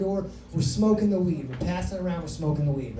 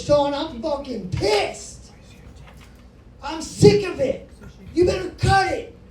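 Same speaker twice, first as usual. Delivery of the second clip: higher, louder angry mom yelling; mad mom; 7 semitones higher, 4 dB louder than usual. Retold or imitated. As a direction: blast him like a furious mother. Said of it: "And she is mad".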